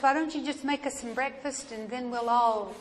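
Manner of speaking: ordinary delivery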